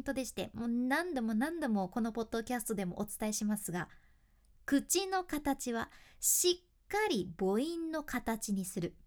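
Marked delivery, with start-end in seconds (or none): 4.7-8.9 drawn out, each vowel shaped; slow and deliberate. Shown as a demonstration. Teaching a podcast rule, slow and deliberate. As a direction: spell the rule out slowly. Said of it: "これ基本です".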